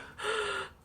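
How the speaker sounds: sharp gasp of shock; 깜짝 놀라 숨을 들이켜기; dramatic gasp. Retold or imitated. Shocked at haircut news.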